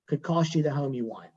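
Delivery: normal talking voice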